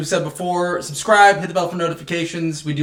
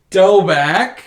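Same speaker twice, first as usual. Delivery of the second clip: slow, slightly raised hopeful asking; mock hopeful plea; 4 semitones higher, 5 dB louder, much slower than usual. Brennan asking stepdad for bunk beds, slow pleading. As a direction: draw the question out tentatively.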